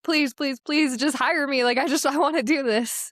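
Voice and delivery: high, eager begging; retold pleading. Would-be intern begging marketing staff to hire her, playful pleading. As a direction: beg for it, laughing at yourself.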